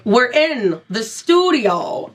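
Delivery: very slow, oddly spaced delivery; halting celebrity impression, drawn way out; Christopher Walken. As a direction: stretch out each phrase in his peculiar dialect. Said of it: "Christopher Walken is like".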